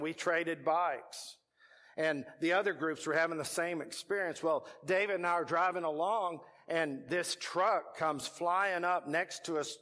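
normal talking voice

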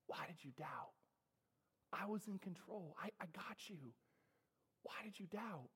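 soft, near-whispered rebuke from Jesus; tender Jesus. Acted. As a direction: gently scold a frightened friend, almost in a whisper. Jesus voiced tenderly to Peter.

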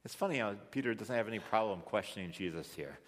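normal talking voice

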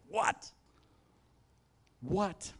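high, incredulous double-take; acted astonishment. Worshipper hearing the Lion is here, high mock astonishment. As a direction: playact a startled question, pitched high. Acts startled reaction to elder's news.

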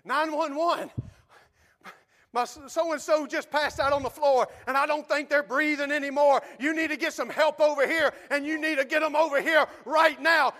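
loud, high, urgent plea for help; urgent shout. Retold or imitated. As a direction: yell it like a frantic emergency call. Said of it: "It's more like this".

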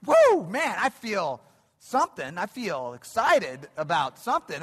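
sudden high, loud burst of awe that settles into eager wonder; worked-up revival thrill. Retold or imitated. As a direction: play a camp meeting kid swept up by the evangelist. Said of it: "you're just like".

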